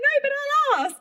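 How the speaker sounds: very high, eager promise; keen retold reply. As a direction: act out an excited, helpful answer.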